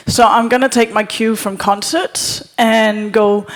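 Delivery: ordinary delivery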